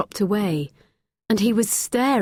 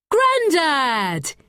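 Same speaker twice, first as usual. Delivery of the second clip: high, surprised cry of Grandad; breathless boy's glad shout; excited call; 8 semitones higher than usual. A narrator's reading. Boy bursts out calling grandfather.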